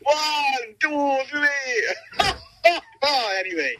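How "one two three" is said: high, drawn-out Transylvanian vampire count; Transylvanian accent. Acted. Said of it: "you could do, like, a Transylvanian".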